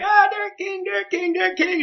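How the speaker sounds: squeezed, very high strained voice; pinned under the bar, forcing out the name; crushed and straining. Retold or imitated. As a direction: gasp it out under a crushing weight. Strained answer under crushing barbell.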